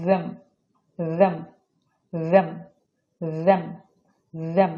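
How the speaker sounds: "them" opened with a voiced TH; demonstrated TH sound. Shown as a demonstration. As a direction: say "them" slowly as a model, voiced TH first. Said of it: "এই দোটা একটু abnormal উচ্চারণ হবে". ("them"-এর শুরুতে ঘোষ TH ধ্বনি)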